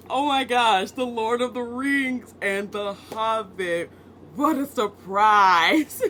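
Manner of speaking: slow, loud, high fake astonishment; sarcastic overblown surprise; mock surprise. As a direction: ham up being shocked by your own pick. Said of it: "I totally didn't say that earlier".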